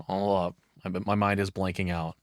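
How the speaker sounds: normal talking voice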